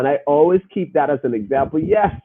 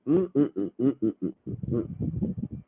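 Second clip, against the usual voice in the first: muffled laugh; 3 semitones lower, 10 dB quieter than usual. Real, not acted. Laughing to himself.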